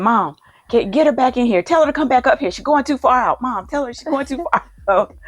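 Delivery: imitating a fretful big brother; worried brother pleading. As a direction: beg mom to bring her back, voice up high. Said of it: "Ken was about to have a fit".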